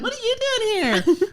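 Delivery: high, eager squeal; excited squeal. Retold or imitated. Narrator rushing over to film the fight, high excited squeal. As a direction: squeal like a thrilled bystander.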